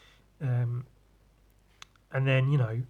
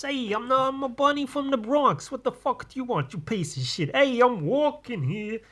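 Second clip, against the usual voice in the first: Bronx Bunny; 11 semitones higher than usual. Retold or imitated. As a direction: do a cartoon bunny from the Bronx, cocky and rude. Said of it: "And he talked like this".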